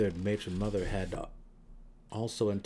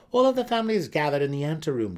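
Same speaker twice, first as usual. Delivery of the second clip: put-on much higher, louder, quick young voice; eager son; 6 semitones higher, 8 dB louder, faster than usual. A narrator's reading. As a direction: rush the report to his mother. Denon speaks.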